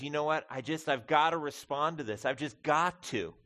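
Paul's words preached with rising urgency; fervent preaching. Acted. Preacher voicing Paul, urgent and fervent. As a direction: press it home like a man who must respond.

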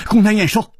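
ordinary delivery, nothing marked

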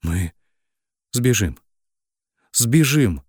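rising desperate outcry; crying out. A narrator's reading. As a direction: shout it desperately the second time. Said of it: "выкрикнула в лицо".

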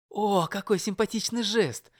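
high, admiring exclaim; delighted. A narrator's reading. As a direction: gasp with admiration. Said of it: "восхитилась соседка".

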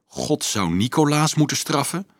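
high, rising, incredulous question; appalled. A narrator's reading. Priest reacts to Marieke's curse on Nicolaas, appalled. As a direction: echo it back in dismay. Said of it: "riep Thaddeus ontzet".

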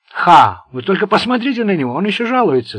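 mock him with poisoned scorn; bitter and venomous. A narrator's reading. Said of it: "с горечью, но не без яда".

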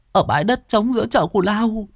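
high put-on tearful voice; fake whimpering. Servant girl faking tears to the young master, high whimper. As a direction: pretend to cry, falsely. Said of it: "mếu máo bằng vẻ mặt giả tạo".

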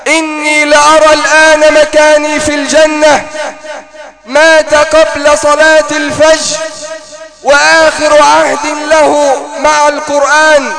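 loud, high impassioned sermon cry; preaching at full force. Acted. Preacher to congregation telling the dying youth's story, impassioned loud preaching. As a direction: pour it all out to the crowd, voice soaring.

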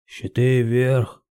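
slow, drawn-out barked order; barked command. A narrator's reading. Chief orders shields up, barked command. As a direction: bark it at the crew. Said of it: "гаркнул Йодор".

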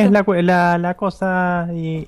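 Chilean accent. Shown as a demonstration. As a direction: drag it out like a Santiago local. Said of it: "habla como un chileno".

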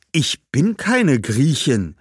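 langsam, Betonung auf keine, fallend; protestierend. Acted. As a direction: widerspricht entschieden. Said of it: "protestierte Badbal". (Badbal protestiert: „keine“ betont)

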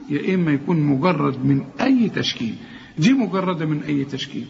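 ordinary delivery

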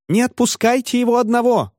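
very high squealing plea; women's shriek. A narrator's reading. Women plead that he not go alone, shrieking high. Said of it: "завизжали женщины".

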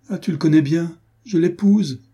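high, loud anguished outburst; cry out. A narrator's reading. Adrienne to Diane, confessing the price, a high loud cry. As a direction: let the cry tear out of her chest. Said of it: "s'écria-t-elle".

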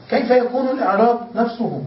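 normal talking voice

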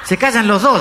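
high, loud order to both; acted exasperated bystander; scolding interjection. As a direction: cut in, fed up. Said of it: "dijo otro tipo".

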